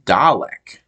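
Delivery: "Dalek" said DAH-lek, with a broad ah; "Dalek" the show's British way, DAH-lek. Shown as a demonstration. American fan quoting the show's British "Dalek", DAH-lek. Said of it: "the way they say it in the show is".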